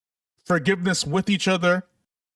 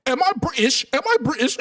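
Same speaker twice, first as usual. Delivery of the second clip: higher-pitched mock British voice; bad British accent; 5 semitones higher than usual. Streamer mimicking indignant British folks, bad British accent. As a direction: put on a poor British accent. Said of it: "I don't have a good British accent".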